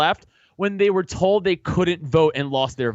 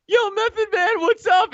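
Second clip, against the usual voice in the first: put-on high, excited teen shouting a hello to his idol; eager kid; 3 dB louder than usual. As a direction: greet a star like a starstruck thirteen-year-old. Teen kid greeting a celebrity.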